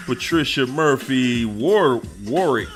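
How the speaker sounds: ordinary delivery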